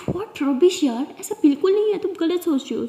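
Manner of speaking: emphatic, exasperated contradiction; indignant denial. Acted. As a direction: dismiss the idea with heated force.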